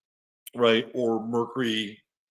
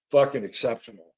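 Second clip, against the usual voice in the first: ordinary talk, nothing marked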